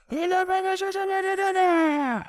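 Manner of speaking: imitating a very high, long-drawn shout; mock high-pitched yell carried across water; Colleton's holler. Rower heard hollering from along the river, high mock shout. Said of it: "we just heard Colleton go".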